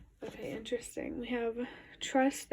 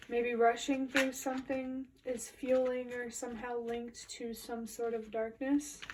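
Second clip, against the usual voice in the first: ordinary talk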